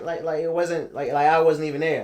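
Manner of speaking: usual speaking voice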